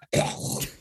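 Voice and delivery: throaty mock hack; rough, voiceless hacking cough; imitated hacking. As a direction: imitate someone noisily clearing out phlegm. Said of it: "he's, like".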